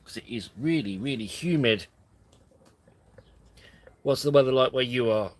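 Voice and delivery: ordinary delivery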